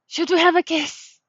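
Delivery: ordinary talk, nothing marked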